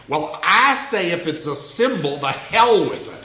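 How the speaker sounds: loud, high Georgia twang. Retold or imitated. Writer breaking into pompous dinner talk, loud Georgia twang. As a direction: blurt it out in a put-on Georgia twang. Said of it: "said in her Georgia twang".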